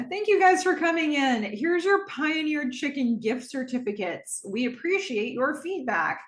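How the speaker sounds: hollow customer-service gratitude; insincere thanks. Retold or imitated. Cam dismissing the testers after the session, fake gratitude. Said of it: "she doesn't really appreciate their feedback".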